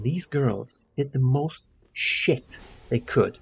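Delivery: usual speaking voice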